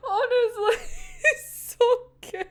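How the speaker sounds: high, squeaky words through laughter; helpless delight. Real, not acted. Fan raving that it's good, real laughing squeal.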